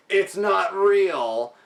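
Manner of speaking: high, drawn-out mock scream; imitated screaming. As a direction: mimic an unhinged scream, stretched out and high.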